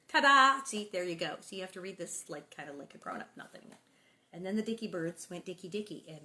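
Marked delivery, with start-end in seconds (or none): 0.1-0.6 ta-da. Real, not acted. Announcing the reveal.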